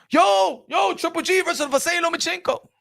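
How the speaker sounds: high-pitched fan hollering a matchup; excited fan. Acted. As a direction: hype it up fast, like a fan calling out. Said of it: "the fans gonna root for it".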